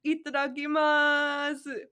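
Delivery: high slow gleeful call before eating; excited cheer. Acted. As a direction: shout thanks for the food. Naruto about to eat.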